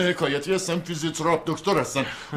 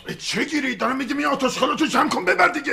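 higher and louder, exasperated; heated protest; 11 semitones higher, 4 dB louder than usual. Acted. Younger brother snaps at his brother to take his junk and go. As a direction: push back hard, fed up. Brothers quarrel over belongings.

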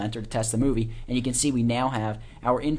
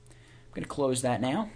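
usual speaking voice

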